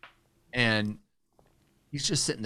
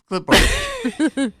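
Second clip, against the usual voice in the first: high, louder word breaking into laughter; laughing; 10 dB louder than usual. Real, not acted. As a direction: lose it mid-quote of dad. Quoting dad and breaking into laughter.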